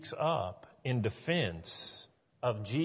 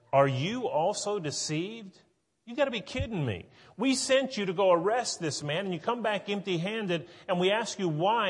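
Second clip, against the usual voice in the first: higher, disbelieving scolding of the officers; incredulous Pharisees; 6 semitones higher than usual. Retold or imitated. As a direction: play the outraged Pharisee who can't believe it.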